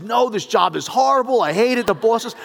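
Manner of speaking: high, loud imitated griping; disgruntled receptionist. Receptionist griping to a caller about work, mock outrage. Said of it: "I'll get some other answers".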